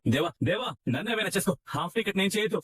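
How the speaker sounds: high-pitched, urgent begging; desperate pleading. Acted. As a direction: plead for another man's life. Father begs villain to spare man.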